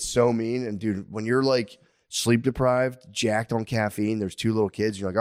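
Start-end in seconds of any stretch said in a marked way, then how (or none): none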